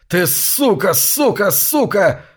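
high furious repeated shouting; свирепый крик. Acted. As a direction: yell each word like a blow. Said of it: "свирепым ударом". (свирепо, выкрикивая каждое слово)

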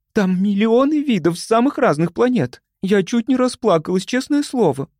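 high-pitched, overwhelmed gushing; gush, nearly moved to tears; breathless delight. A narrator's reading. Любочка raving about countless species, overjoyed. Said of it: "задыхалась от восторга Любочка".

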